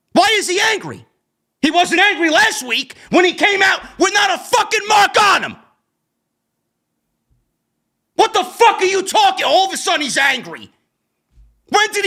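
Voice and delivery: exasperated shouting at the booking; angry rant. Real, not acted. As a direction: yell the questions in disbelief. Ranting about the storyline.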